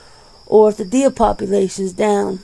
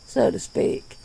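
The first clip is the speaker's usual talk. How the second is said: laughing; 3 semitones higher, 8 dB quieter than usual. Real, not acted. Laughing aside after pun.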